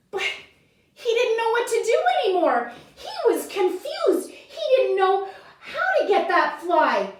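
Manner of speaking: high-pitched, flustered storytelling; flustered confusion. A narrator's reading. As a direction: narrate the muddle in a worked-up high voice. Narrates chameleon's mounting confusion.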